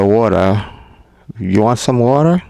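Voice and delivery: lightly imitating an American asking; American accent. Vlogger imitates an American offering water, light American accent. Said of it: "So you say".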